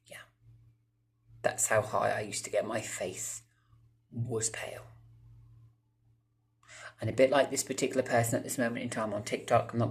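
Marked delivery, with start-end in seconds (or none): 4.1-4.8 breathy dramatic whisper; whispered aside. Acted. Telling viewers how pale his face went, whispered. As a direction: drop to a spooky whisper.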